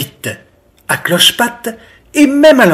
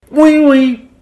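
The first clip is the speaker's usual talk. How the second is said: high muffled little yes; mouth full; 9 semitones higher than usual. A narrator's reading. Biquette to her mother, mouth full. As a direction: answer through a mouthful of cake. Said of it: "fait Biquette la bouche pleine".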